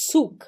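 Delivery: Zug with initial ts, "tsuk". Shown as a demonstration. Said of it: "Ele tem esse tss". (Zug dito "tsuk")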